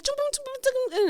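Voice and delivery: very high, rapid gibberish imitating Indian speech; high-pitched caricature of an Indian language; mock Indian speech. Guest mimicking how she talks while praying, mock Indian speech. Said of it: "But the way she talks".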